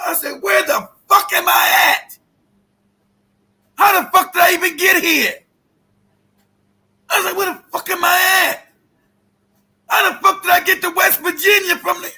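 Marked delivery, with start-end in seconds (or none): 0.4-5.3 high, loud disbelieving shout; retold disbelief. Man lost in West Virginia shouting in disbelief. Lost after ten hours driving. 7.4-12.1 retold disbelief. Man lost in West Virginia shouting in disbelief. Lost after ten hours driving.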